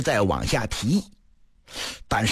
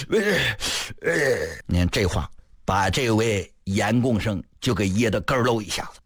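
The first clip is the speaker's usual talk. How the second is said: ordinary delivery, nothing marked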